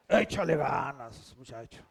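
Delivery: parodia con voz grave que se apaga al final; ánimo paródico. Retold or imitated. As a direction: imita en grave a quien da ánimos vacíos. Repite la parodia, más enfática. (palmadita verbal de burla, voz grave)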